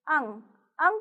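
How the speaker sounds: अं sounded through the nose; nasal anusvara sound. Shown as a demonstration. Teacher shows children the anusvara, अं said through the nose. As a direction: say अं with a nasal ending. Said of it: "इसका उच्चारण नाक से होता है".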